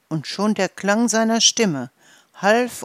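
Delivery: ordinary delivery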